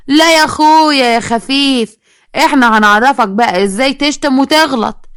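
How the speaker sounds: slow, drawn-out, high-pitched taunt; mocking threat. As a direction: stretch the words in menacing sarcasm. Child menacingly mocks the man. (تهديد ساخر ممطوط)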